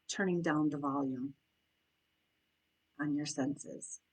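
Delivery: soft, unhurried meditation voice with long pauses; guided meditation. Acted. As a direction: guide them gently into noticing their breath and senses.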